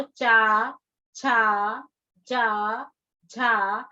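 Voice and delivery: "चा", "छा", "जा", "झा" said one by one, clearly, with a pause between; syllable demo. Shown as a demonstration. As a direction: spell out the sounds slowly for the class. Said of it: "आप बोल के देख सकते हो". (अक्षरों को साफ़-साफ़ अलग-अलग बोलना)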